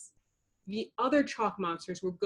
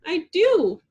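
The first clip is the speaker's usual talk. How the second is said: higher, louder frightened insistence; shaking; 9 semitones higher, 7 dB louder than usual. A narrator's reading. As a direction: insist while trembling. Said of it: "Bubbles said, shaking".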